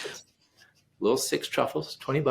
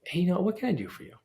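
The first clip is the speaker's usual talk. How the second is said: "what can I do for you?" lifted higher and quicker; generous offer; 8 semitones higher, faster than usual. Shown as a demonstration. Turning the narrative around, a bright generous offer. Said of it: "turn that narrative around".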